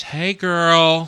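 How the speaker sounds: high, loud, gushing coworker greeting; fake-friendly greeting. Mimics two-faced coworker greeting.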